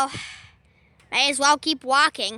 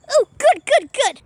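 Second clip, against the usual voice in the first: gleeful cheer; 8 semitones higher, 5 dB louder than usual. Acted. Poplio cheers.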